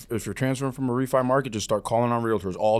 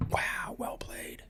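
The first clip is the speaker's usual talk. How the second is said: whisper; 10 dB quieter, much slower than usual. Real, not acted. Teasing whispered aside.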